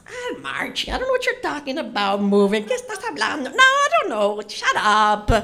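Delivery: high, loud brush-off; dismissive mom. Retold or imitated. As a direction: deny everything to the kid.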